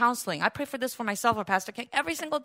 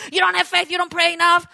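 judgy Pharisee; 5 semitones higher, 6 dB louder than usual. Retold or imitated. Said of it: "judgy and, like, Pharisaic".

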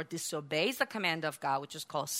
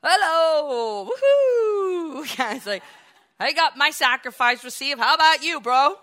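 loud, much higher, whooping brag at his brother; gloating cheer; 8 semitones higher, 10 dB louder than usual. Retold or imitated. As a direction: crow with delight like a smug winner. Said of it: "Abel went like".

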